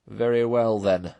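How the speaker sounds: loud, firm pronouncement. A narrator's reading. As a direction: lay down the law. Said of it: "said the Badger firmly".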